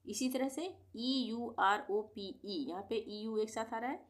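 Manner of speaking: usual speaking voice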